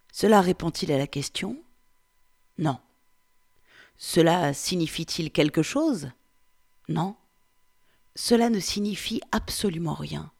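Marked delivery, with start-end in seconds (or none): none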